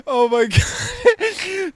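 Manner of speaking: high, drawn-out laughing wail; real breathless laughing fit; crying with laughter. Real, not acted. Friend laughing hardest in ten years, tearful laughter. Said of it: "this is the hardest I've laughed in".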